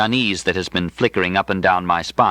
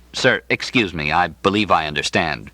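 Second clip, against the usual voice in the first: ordinary delivery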